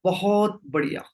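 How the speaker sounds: low, unhurried meditation-guide voice with long pauses; guided meditation. Real, not acted. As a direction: settle the group gently, leaving long silences. Leads group into meditation.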